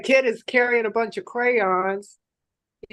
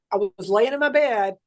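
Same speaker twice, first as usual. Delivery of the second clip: normal talking voice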